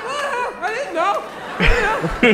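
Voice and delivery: high, giggling fake innocence; squeaky laughing mock innocence; mock innocent laughing. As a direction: play dumb through laughter. Feigning innocence to store clerk.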